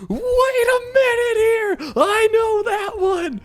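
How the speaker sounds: high, slow, drawn-out cry; excited realization. Retold or imitated. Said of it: "I'm like".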